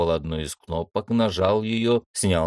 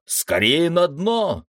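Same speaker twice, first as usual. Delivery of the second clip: high urgent cry to dive; desperate shout; 9 semitones higher, 3 dB louder, slower than usual. A narrator's reading. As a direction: call out in alarm, high and drawn out. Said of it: "отчаянно закричал Горелов".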